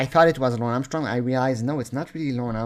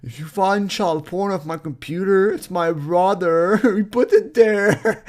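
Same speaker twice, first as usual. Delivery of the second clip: mocking the suspect; 6 semitones higher, 6 dB louder than usual. Ranter imitating Rob Klein throwing his brother under the bus, mocking. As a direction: mimic a blame-shifter, laughing through it. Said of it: "He's like".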